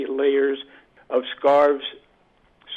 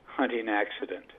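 dull, flat voice with no expression; flat monotone; 8 dB quieter than usual. A narrator's reading. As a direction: say it as if nothing matters. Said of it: "Her voice was flat, almost a monotone".

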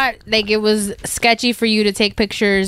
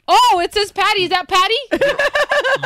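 excited yell; 8 semitones higher, slower than usual. Real, not acted. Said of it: "You don't have to yell".

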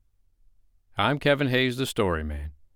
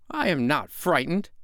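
put-on defiant child; 7 semitones higher than usual. A narrator's reading. Child denies fear in quarrel.

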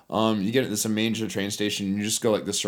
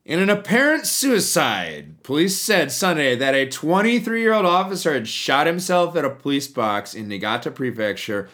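news read; 5 semitones higher, 3 dB louder than usual. Acted. Reading an article to the podcast audience, news-anchor style.

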